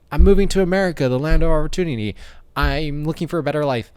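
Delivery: high, loud, imitated newcomer voice; hopeful immigrant. New immigrant arriving for a better life, high put-on voice. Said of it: "he doesn't understand English. He's like".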